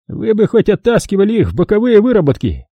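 put-on high, grumbling old man voice; old prospector. A narrator's reading. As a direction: play a cranky old miner. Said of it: "недовольно заметил старик".

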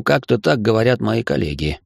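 plain reading voice

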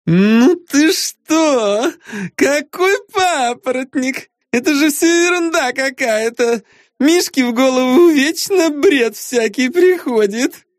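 high, drawn-out scoffing woman; high dismissive diva. A narrator's reading. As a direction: scoff like a spoiled star. Lara dismissing the quest.

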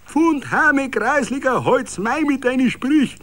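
high, loud jeering shout; laughing crowd yaps back loudly; yapping heckle. A narrator's reading. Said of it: "kläfften rundherum die Lachenden".

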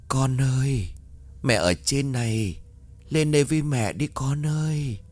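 eerie, distant call from above; a dead mother luring her child; ghostly mother's voice. A narrator's reading. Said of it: "Hiền nhận ra giọng của mẹ mình".

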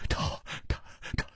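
barely voiced, rasping stammer; hoarse voice. A narrator's reading. Poisoned man stammering to Chu Liuxiang, hoarse and faint. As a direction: choke the words out with almost no voice. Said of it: "他哑声说".

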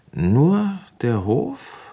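slow, high drawn-out question; probing question. Acted. Inspector pressing the notary about the inheritance, slow and probing. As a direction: stretch it out, pointed and high.

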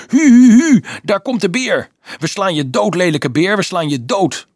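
put-on high, loud childish bear howl; shrill-pitched play battle cry; excited little boy. A narrator's reading. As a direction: whoop it out like a small boy at play. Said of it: "nog altijd roepend".

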